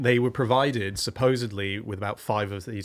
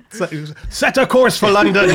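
mock ship captain; 10 semitones higher, 9 dB louder than usual. Imagined captain giving orders.

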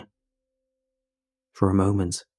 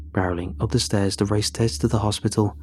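plain reading voice

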